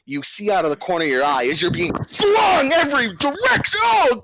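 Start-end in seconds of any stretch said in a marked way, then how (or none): 2.1-4.2 high, loud cry; mock panic. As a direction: yelp as you are flung about. Narrator in the man's panic.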